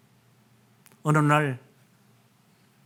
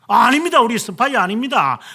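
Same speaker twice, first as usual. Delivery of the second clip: higher, louder flustered denial; acted protest; 5 semitones higher, 3 dB louder than usual. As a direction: protest the accusation, voice jumping up. Brothers deny the spy accusation.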